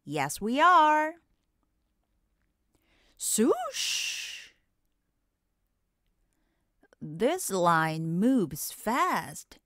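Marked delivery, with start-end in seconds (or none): 3.2-4.5 high airy swoosh of a coaster; swoosh sound. Acted. Narrator imitating the coaster rushing by, airy swoosh.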